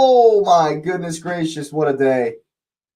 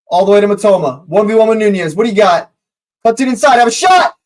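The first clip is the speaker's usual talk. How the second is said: commentary building to a yell; excited fan commentator building tension; live play-by-play; 5 semitones higher, 4 dB louder, much faster than usual. Real, not acted. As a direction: call the move as it unfolds, louder and higher.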